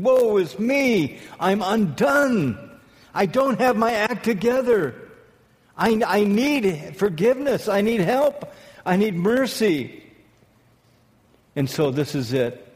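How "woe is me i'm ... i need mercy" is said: higher, slow plea for mercy; lightly voiced broken confession, a bit higher; pleading prophet. Isaiah confessing before the Lord, a slow, higher plea. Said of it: "and he said".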